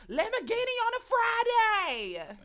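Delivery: put-on high, loud city girl voice; brash rap boast voice; city girl impression. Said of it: "Carisha is mine".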